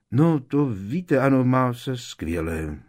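a touch of forced cheer; hearty. Acted. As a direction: cover the tension with a hearty boom. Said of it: "zahlaholil Lupin".

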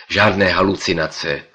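plain reading voice

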